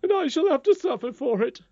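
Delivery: high, loud acted distress; starting to cry. Nurse admitting they broke the law, tearfully. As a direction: wail it as an upset nurse. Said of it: "beginning to cry again".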